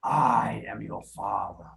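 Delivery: low, slow whispered Vader line; teacher models Vader again for Lennon; whispered Darth Vader. Shown as a demonstration. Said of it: "can you do that".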